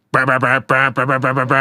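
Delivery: buzzy brr sounds mimicking the track; imitated buzzing noise. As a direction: mimic a glitchy synth buzz with your lips. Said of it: "it goes".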